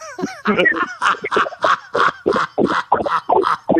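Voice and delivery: long, high helpless laughter; real laughing fit. Real, not acted. Friend mimicking watch this, then laughing hard. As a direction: crack up at your own story. Cracking up at the story.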